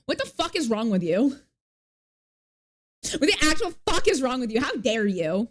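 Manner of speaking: playfully indignant, pitch jumping up on the repeat; hammed-up indignation; mock outrage. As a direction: act scandalized at chat. Mock outrage at chat message.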